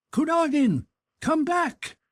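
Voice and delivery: high urgent shout from the gate. Acted. Dolokhov shouts a warning to his friend at the gate, high and loud. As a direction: call him back at the top of your voice. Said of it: "shouted Dolokhov".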